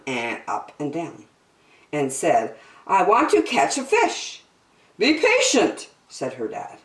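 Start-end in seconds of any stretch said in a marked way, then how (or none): 2.9-4.4 put-on high, eager child voice; impatient little girl. 5.0-5.9 high, emphatic reproof; admonishing dad. Acted. Dad answers impatient girl.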